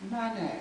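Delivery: "money" said as "manay", high and falling; posh money. Shown as a demonstration. Reader showing how the posh man said "money". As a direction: clip "money" to a plummy "manay". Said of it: "It sounded like".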